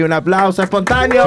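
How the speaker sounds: rally the room; announcer call. Real, not acted. Calling for applause.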